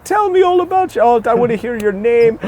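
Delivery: high-pitched mock-Irish eagerness; fawning Irish crowd. Irish locals rallying round the American visitor, gushing high mock-Irish voice. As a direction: gush in a comically high, loud villager voice. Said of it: "they start going up and they rally around".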